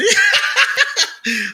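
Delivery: shrieking high laugh, thrown back; helpless high-pitched laughter; high burst of laughter. Real, not acted. Cracking up at the host's deacon joke, high laugh.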